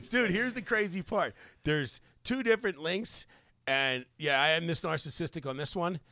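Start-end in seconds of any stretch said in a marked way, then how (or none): none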